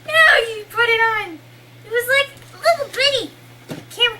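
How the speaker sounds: high, loud cry of dismay; excited shout. Real, not acted. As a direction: yell after the escaping spider. Spider escapes, she cries out.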